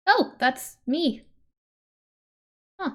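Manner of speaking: ordinary talk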